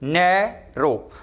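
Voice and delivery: नैरो split in two slow beats, "NAI... ro"; syllable-by-syllable demo. Shown as a demonstration. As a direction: stretch नैरो, pausing between "nai" and "ro". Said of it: "अब देखें two syllable है word".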